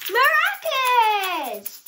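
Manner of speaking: high, loud and slow, stretched word; proud slow announcement; triumphant drawn-out. Acted. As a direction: land the big reveal. Climax of Maca's speech.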